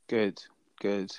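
usual speaking voice

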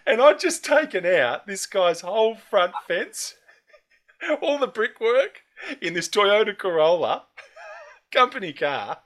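cracking up while telling it; laughing. Real, not acted. Laughing at his own crash story.

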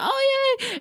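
very high, sugary fake delight; mock fake cheer. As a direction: cheer with hollow enthusiasm.

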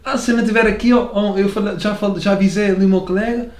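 high-pitched, clumsy Alentejo imitation; rough Algarve-Alentejo accent, high and pushed; attempted Alentejo accent. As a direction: badly imitate an Alentejo local, pitched well up. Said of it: "não sei imitar, peço desculpa, pessoas de cá".

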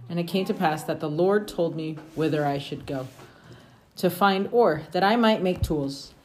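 steady, measured reading of scripture. Acted. Parent reading scripture to the family, measured and steady. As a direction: read it aloud to the family like scripture.

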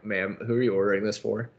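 usual speaking voice

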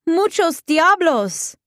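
high, drawn-out yell; outraged cry, stretched out. A narrator's reading. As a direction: yell it high and slow in Spanish. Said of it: "she yelled".

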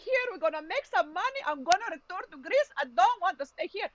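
high-pitched imitation of mom's Greek accent; mother's Greek accent. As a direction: quote mom in a thick Greek accent. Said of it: "one of these things in her accent".

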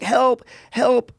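high, imitated plea for rescue; mock cry for help. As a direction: imitate a drowning recruit calling out. Imitates drowning recruit calling out.

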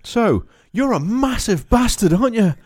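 high, loud, laughing mock awe; Piers Morgan. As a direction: squeal it out, barely holding the laugh. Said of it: "Piers Morgan just goes".

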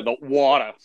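mock Long Island vowel in water; Long Island accent. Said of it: "She always has to say water".

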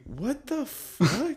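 slow, high stunned disbelief; stunned clerk. Retold or imitated. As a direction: play a baffled DMV clerk. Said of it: "Someone, like, at the D M V".

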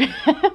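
short high nervous laugh. Real, not acted. Vlogger laughing at her own vulnerability, short nervous laugh. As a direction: laugh it off, a bit embarrassed.

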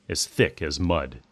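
plain reading voice

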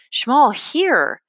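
high, urgent coaxing call. A narrator's reading. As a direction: push the food on him, urgently. Bruno urging frightened friend.

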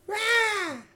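very high, hammed-up cheer Rah!; high whoop of a rowdy audience; mock crowd roar. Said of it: "they go".

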